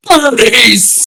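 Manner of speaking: high, gleeful cry; delighted animal lover's squeal of greeting; excited. Acted. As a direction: call out in childlike excitement. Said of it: "he's getting excited".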